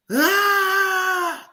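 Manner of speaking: long, high mock scream; imitated night scream. Acting out screaming asleep with eyes open, mock scream. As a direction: let out a sudden high scream. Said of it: "I'm up screaming".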